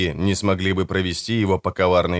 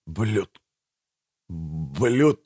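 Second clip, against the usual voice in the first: soft, halting, broken-off syllables; laboured dying speech; 3 semitones lower, 5 dB quieter than usual. A narrator's reading. Dying Bertram tries to curse the brothers, faint and laboured. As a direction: struggle to force out half a word, weakly.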